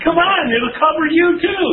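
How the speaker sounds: coaxing neighbor. Acted. Neighbor urging the blood covering on you, high and insistent. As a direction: push the offer in a high, insistent voice.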